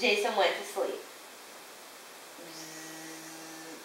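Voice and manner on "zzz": very quiet, low, sustained buzz; soft snoring buzz. A narrator's reading. Jason gone to sleep, soft snoring buzz. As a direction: hum a long sleeping zzz.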